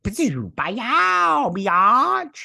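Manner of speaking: high, loud gleeful gun noises; mock blaster shots. Crosshair shooting his way out, yelling blaster noises. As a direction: shout the shots like a kid playing. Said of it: "he's like".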